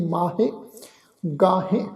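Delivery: ਮਾਹੇ and ਗਾਹੇ with nasalised final vowels; nasal endings. Shown as a demonstration. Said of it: "ਇਹ ਬਿੰਦੀ ਸਹਿਤ ਸ਼ਬਦ ਉਚਾਰਨ ਹੋਣਗੇ".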